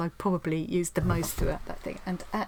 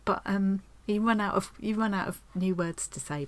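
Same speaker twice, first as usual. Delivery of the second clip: ordinary talk, nothing marked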